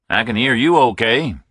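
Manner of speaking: put-on Southern accent, unbothered; a Southern caller reassures the phone company; easy southern caller. A narrator's reading. Hubble voiced on speakerphone.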